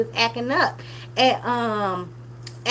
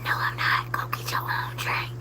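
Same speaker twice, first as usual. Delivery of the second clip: voiceless whisper, much softer; whispered refusal; 9 dB quieter than usual. Pretend. Vlogger whispering her refusal, as if to the daughter. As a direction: whisper the refusal so nobody else hears. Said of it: "Whisper that".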